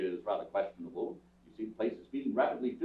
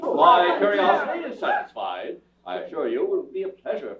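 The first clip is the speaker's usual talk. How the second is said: declaiming loudly; 6 semitones higher, 9 dB louder than usual. Real, not acted. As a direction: address the room with scorn. Tramp rebukes the crowd.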